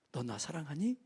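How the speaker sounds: soft, low, gentle asking; tender question. Acted. Jesus asking Peter after breakfast, soft and tender. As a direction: ask it quietly like a loving teacher.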